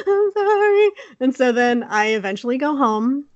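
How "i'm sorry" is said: high, sobbing sorry; mock crying sorry. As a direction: whimper it like a guilty kid in tears. Child apologizing after hurting friend.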